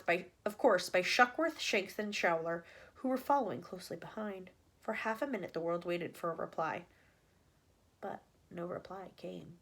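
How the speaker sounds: plain reading voice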